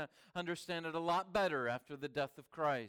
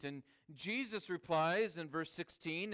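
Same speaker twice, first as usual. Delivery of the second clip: usual speaking voice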